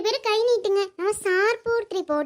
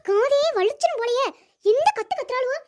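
put-on higher, cranky old granny complaining; grumpy grandmother; 5 semitones higher than usual. Grandma scolding noisy kids playing near her, cranky.

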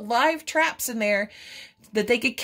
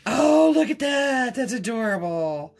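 ordinary talk, nothing marked

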